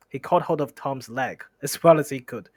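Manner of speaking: ordinary delivery, nothing marked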